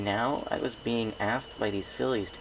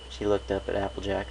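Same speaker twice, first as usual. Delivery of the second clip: ordinary delivery, nothing marked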